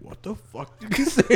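a mock-furious what-did-you-say; indignant outrage. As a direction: demand it like you've been insulted. Quoting indignant character.

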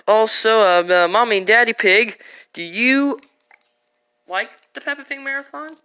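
ordinary talk

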